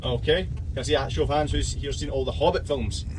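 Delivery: usual speaking voice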